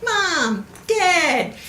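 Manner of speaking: high little Dad; toddler voice. Retold or imitated. Toddler calling Dad at Bluey, high and cute. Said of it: "it's like".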